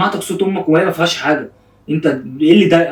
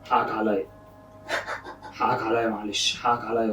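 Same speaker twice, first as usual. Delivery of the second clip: soft, low consoling voice; tender, hushed sorry; soothing apology; 5 semitones lower, 12 dB quieter than usual. Real, not acted. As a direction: apologize gently and quietly, pitched down. Soothing crying friend with apology.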